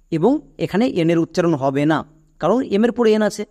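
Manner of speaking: ordinary talk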